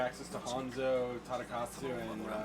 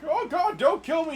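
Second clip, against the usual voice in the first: high, loud mock pleading; begging for life; 13 semitones higher, 9 dB louder than usual. Victim begging his killer, mock terrified. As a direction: beg in hammed-up panic. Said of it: "And he's like".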